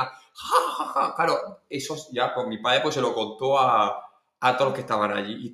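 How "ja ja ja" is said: high mock belly laugh; imitated helpless cackling; father's imitated guffaw. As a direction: laugh out like someone in stitches. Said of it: "le caían de la risa que tenía".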